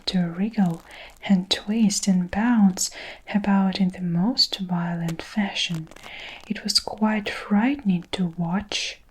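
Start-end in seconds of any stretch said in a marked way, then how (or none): none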